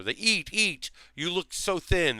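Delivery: a hint of a doting grandma urging food; mock fussing grandma; grandmother caricature. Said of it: "that kind of, like, caricature".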